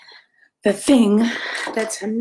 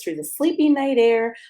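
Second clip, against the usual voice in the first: usual speaking voice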